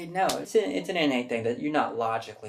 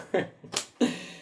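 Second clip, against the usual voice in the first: breathy laugh; 8 semitones higher than usual. Real, not acted. Laughing at the Whoopi Goldberg bit. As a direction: laugh under your breath.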